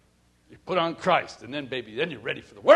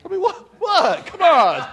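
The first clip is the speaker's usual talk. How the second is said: high, loud indignant whine; protesting husband, pitched way up; mock outraged protest; 12 semitones higher, 9 dB louder than usual. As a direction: squeal it like a scolded husband. Protesting wife's verdict, high loud.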